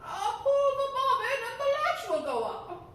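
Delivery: put-on high, loud old granny call; grandmother calling out. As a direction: call out from bed as an old woman. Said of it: "cried out".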